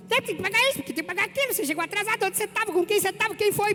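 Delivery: high, rapid-fire nagging questions; nagging wife. Retold or imitated. Wife nagging husband arriving late, high nagging voice. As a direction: pelt him with complaints in a high nag. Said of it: "Você fica assim".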